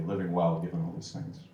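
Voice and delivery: ordinary talk, nothing marked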